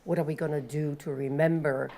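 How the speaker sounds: ordinary delivery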